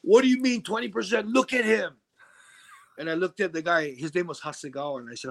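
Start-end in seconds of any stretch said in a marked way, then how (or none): 0.0-2.0 indignant retort. Retold or imitated. Yakuza higher-up objecting about the beaten man, indignant. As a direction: imitate an outraged boss, pitched up. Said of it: "And he goes".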